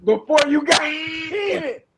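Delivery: slow, high shout breaking into laughter; laughing. Real, not acted. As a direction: crack up loudly mid-sentence. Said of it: "why I'm laughing".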